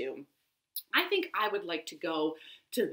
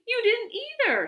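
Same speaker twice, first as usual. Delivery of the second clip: very high, thrilled exclamation; excited shout; 4 dB louder than usual. A narrator's reading. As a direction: answer with equal delight, voice way up. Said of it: "shouted Bat".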